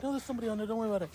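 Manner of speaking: high, singsong brush-off; teasing reassurance. Retold or imitated. Prankster leaving reassures stranded friend, teasing reassurance. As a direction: wave off his worry in a teasing high voice.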